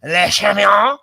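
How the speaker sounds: mock French accent; hammed-up Pepe Le Pew French. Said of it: "You sounded like Pepe Le Pew".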